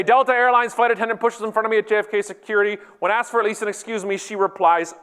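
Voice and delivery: tweet read-out. Acted. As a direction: proclaim a complaint tweet without pausing. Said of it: "And I say".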